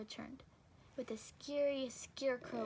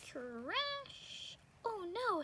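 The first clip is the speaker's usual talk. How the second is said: crash sound; 9 semitones higher, 3 dB louder, much slower than usual. Acted. Window breaks, Amelia exclaims.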